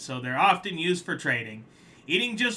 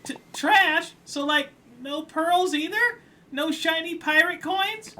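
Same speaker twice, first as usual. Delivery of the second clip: much higher, stammered T-, trash? in disbelief; surprised crab; 6 dB louder than usual. Acted. Crab stunned by trash currency, high baffled voice.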